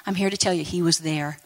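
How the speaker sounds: usual speaking voice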